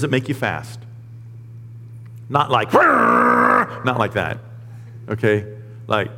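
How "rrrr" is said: low, loud growling "rrrr"; rolled motor noise, low and forceful; imitated revving noise. Shown as a demonstration. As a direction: rev it like a racing engine. Jokes on fast as speed.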